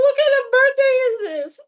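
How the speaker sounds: very high, loud incredulous cry; outraged disbelief. Retold or imitated. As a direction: demand what kind of birthday this is. Her outraged question.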